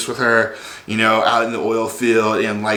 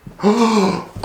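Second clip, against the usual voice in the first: mock gasp; 8 semitones higher than usual. Shocked wife realising.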